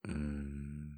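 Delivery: normal talking voice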